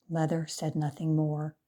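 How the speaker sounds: usual speaking voice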